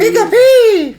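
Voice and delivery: very high, loud cartoon creature squeal; Pikachu squeak. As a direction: squeak it out like a tiny electric mouse. Mimics Pikachu's cry.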